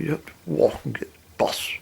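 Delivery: whispered aside from the barmaid. Retold or imitated. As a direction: whisper it, softly refusing. Barmaid quietly refusing a taxi.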